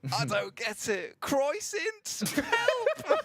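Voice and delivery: high, squeaky put-on confused voice; mock confusion. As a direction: play someone baffled by the word croissant. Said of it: "Blank's there, just looking at the word croissant, trying to figure out how to say it. Just confused".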